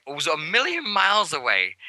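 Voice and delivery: ordinary talk, nothing marked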